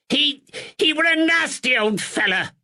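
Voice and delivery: high, pleading northern shopkeeper; cornered man whining his innocence; frightened draper. Acted. Nervous draper pleads with the constable in a high voice.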